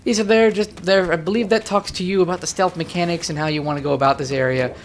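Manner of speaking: usual speaking voice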